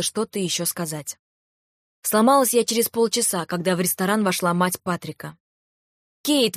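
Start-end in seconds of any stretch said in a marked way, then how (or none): 6.2-6.6 the name called out high and bright, falling at the end; joyful exclamation. A narrator's reading. Mother-in-law greeting Kate in the restaurant, happy exclamation. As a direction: cry out the name on seeing her. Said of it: "воскликнула она".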